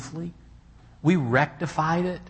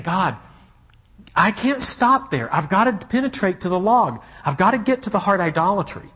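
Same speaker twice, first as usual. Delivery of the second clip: preacher voicing his own urgent prayer, raised and intense; fervent prayer; 7 semitones higher, 5 dB louder than usual. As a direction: cry out with resolve. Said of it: "now I'm saying".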